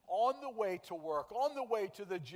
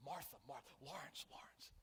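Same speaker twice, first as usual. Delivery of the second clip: breathy unvoiced whisper, name said twice; 3 semitones lower, 12 dB quieter than usual. Preacher whispering names to the congregation, hushed. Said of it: "Jesus is whispering our names".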